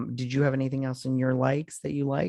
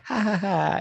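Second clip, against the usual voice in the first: higher mock ha-ha; imitated fun voice; 7 semitones higher than usual. Teasing a co-host by copying the laugh, in a fun voice. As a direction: lightly mimic a silly laughing voice. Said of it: "you did in your fun voice".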